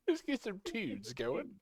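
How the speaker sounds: quiet teasing copy stressing "tunes"; mocking imitation. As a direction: mimic their odd "tunes" teasingly. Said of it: "Why do you say it like that?".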